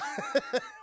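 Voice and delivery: high-pitched giggle trailing down; real amused cackle; high laugh. Real, not acted. Laughs at impression.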